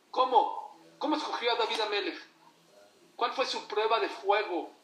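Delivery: preacher's loud rising call; rhetorical challenge. Real, not acted. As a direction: press the crowd for an answer.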